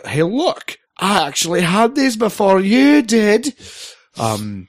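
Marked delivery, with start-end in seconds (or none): 0.1-3.5 high, loud smug bragging; smug boast. Pretend. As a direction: brag like a smug veteran. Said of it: "in order to say".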